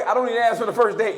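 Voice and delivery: normal talking voice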